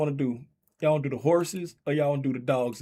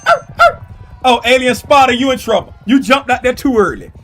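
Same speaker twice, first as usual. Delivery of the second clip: loud mock scold; 9 semitones higher, 14 dB louder than usual. Mock scolding false start.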